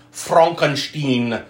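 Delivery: Frankenstein said "FRONK-en-shteen"; joke pronunciation. Pretend. Host announcing the film, Frankenstein jokily said "Fronkenshteen". Jokey mispronounced film title.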